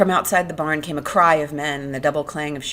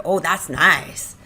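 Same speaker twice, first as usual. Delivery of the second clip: slower, louder drawn-out delight; growing pleasure; 7 dB louder, much slower than usual. Acted. Lenny stroking harder, mounting delight. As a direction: stretch it out, more carried away.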